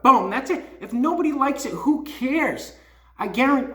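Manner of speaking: high, loud and emphatic; fired-up rant. Real, not acted. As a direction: pump the words up with real conviction. Rant peaks at Drew.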